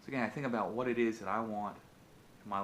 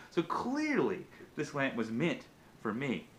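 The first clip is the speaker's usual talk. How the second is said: boastful king; 3 semitones higher, 5 dB louder than usual. Acted. Greedy king gloating to himself about his two palaces. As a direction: crow it like a king sure the lamp is his.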